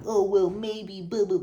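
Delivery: high, loud sneering mimic of a doubter; mocking whiny voice. Mocking doubting viewers with a whiny put-on voice. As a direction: mimic doubters whining excuses. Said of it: "going like".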